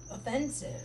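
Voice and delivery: ordinary talk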